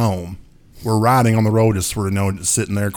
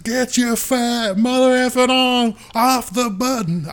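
high, slow mock angry yell; hammed-up fury at the friend; 13 semitones higher, 3 dB louder, slower than usual. Friend yelling about the arm on the button, high mock anger. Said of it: "He's like".

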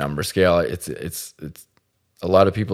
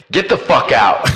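loud, higher mock yell; kicking her out; 7 semitones higher, 6 dB louder than usual. Host booting the girl off his podcast, yelling.

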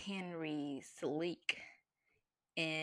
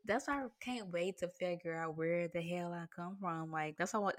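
ordinary talk, nothing marked

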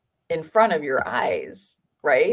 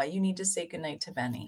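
hushed aside to the room; quiet aside; 12 dB quieter, faster than usual. Real, not acted. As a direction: drop the voice for a side remark. Quiet aside during the podcast.

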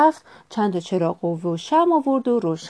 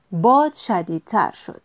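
usual speaking voice

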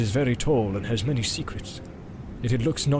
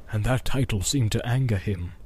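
plain reading voice

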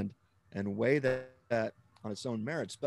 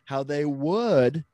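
WOULD louder and held long; slow down and punch "would"; drawn-out, emphatic "would"; stress on WOULD; 4 semitones higher, 6 dB louder, much slower than usual. Shown as a demonstration. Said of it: "see, I didn't use the word could".

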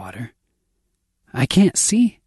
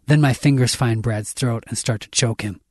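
ordinary delivery, nothing marked

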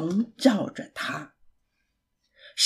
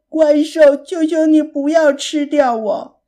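higher, louder tearful begging; crying plea; 4 semitones higher, 6 dB louder than usual. A narrator's reading. Pig begs as the monster opens its mouth, crying plea. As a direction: cry out for mercy. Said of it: "艾利克斯哭喊着".

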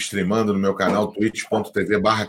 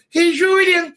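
King Julien voice; 7 dB louder, slower than usual. Shown as a demonstration.

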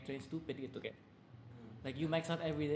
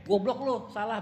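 louder, higher imitation of a blunt telling-off; mock scolding; 6 semitones higher, 8 dB louder than usual. Coach imagining rudely correcting a member, mock scolding. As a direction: act out snapping at someone's mistake.

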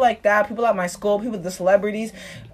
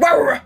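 higher, louder imitated snarling noise; hammed-up "rah rah" outburst; mock angry roar; 5 semitones higher, 4 dB louder, faster than usual. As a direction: act out a TV character's anger as noise. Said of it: "when the dark-skinned girl was on screen".

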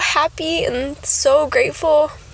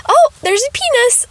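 high excited quote; 12 semitones higher, 5 dB louder than usual. Retold or imitated. Retelling sonographer's reveal.

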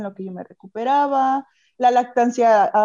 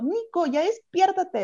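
higher, drawn-out mock scolding; retold annoyance; 8 semitones higher, slower than usual. New mother waking her husband, retold as annoyed. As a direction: imitate an exasperated wake-up call, stretched out. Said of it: "así bien enojada".

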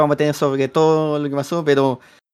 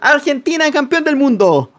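breakneck goal commentary; 7 semitones higher than usual. Pretend. Celebrating world title, commentator style.

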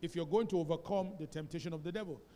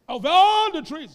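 louder, higher burst, slowed; sudden loud exclamation; 9 semitones higher, 17 dB louder, slower than usual. Real, not acted. Pastor stressing God's freedom to Adam, a loud burst. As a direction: throw it out with full force.

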